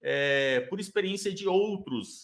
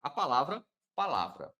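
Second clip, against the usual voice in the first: ordinary delivery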